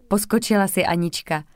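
ordinary delivery, nothing marked

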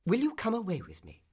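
a touch of urgency in the question; swallow's final plea. A narrator's reading. Swallow finally asking the reed, mildly urgent. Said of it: "he said finally to her".

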